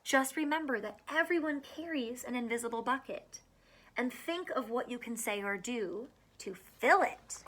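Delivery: lively picture-book read-aloud; reading to children. Acted. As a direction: read it out to a class of small kids. Reads picture book to kids.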